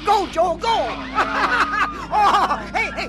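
loud excited yelling with laughter; egging on the driver; cheering shout. Acted. Cheering friend in drag race.

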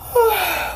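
high drowsy sigh; a long tired sigh; sleepy sigh. A narrator's reading. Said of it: "between sleep and yawn".

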